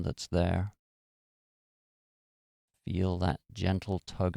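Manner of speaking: calm, measured meditation guide; guided meditation. Real, not acted. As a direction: ease them into relaxation with long pauses between phrases. Leads a guided relaxation meditation.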